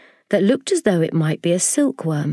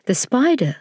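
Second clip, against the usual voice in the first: plain reading voice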